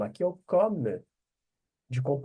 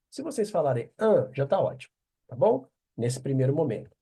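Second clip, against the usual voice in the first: ordinary delivery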